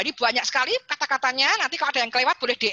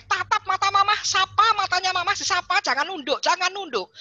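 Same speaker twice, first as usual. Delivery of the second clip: put-on louder and higher, a mother drilling her child; mother coaching her daughter to look up, loudly; 7 semitones higher, 4 dB louder than usual. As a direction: push each instruction out firmly.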